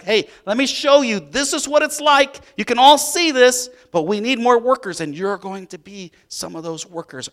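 high, loud rallying of the disciples; eager Jesus teaching. Acted. Jesus rallying his disciples for the harvest, eager and loud. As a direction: fire up your followers. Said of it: "He's like".

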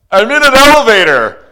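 high, loud, sustained shout; yelled up the shaft. Retold or imitated. Trapped man yelling up the shaft, loud. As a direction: shout it up to the rescuers. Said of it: "I yelled back up the shaft".

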